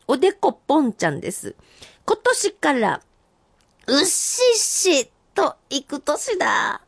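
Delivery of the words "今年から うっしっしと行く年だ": senryu recital. Acted. Reading senryu aloud.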